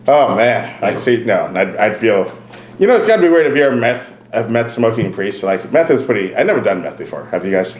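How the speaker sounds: normal talking voice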